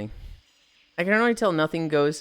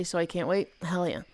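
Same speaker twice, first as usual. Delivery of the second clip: usual speaking voice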